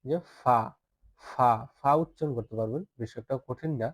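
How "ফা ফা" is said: ফা with a light puff of air; airy fa. Shown as a demonstration. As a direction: blow lightly through the f of ফা. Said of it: "হালকা বাতাস করবেন".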